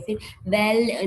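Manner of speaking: ordinary talk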